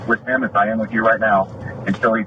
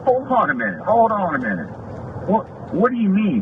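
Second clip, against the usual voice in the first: much higher, taken-aback objection; retold "whoa there" protest, pitched up high; indignant protest; 9 semitones higher than usual. Deputy objects to officer pointing at his wife, indignant.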